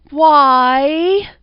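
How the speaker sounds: high, loud, eerie falsetto like a ghostly ex-wife; eerie falsetto cry. A narrator's reading. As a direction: call out in a strained high falsetto from the dark. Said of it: "the falsetto voice had emanated".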